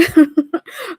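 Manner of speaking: loud, high burst of laughter; real laugh. Real, not acted. Host laughs at a viewer's petty joke, loud laughing. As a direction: break out laughing.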